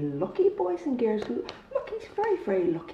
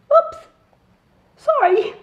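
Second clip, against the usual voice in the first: high, loud laughing apology; giggly oops; 11 semitones higher, 9 dB louder, slower than usual. Acted. Pip apologizing to Albert with a giggle.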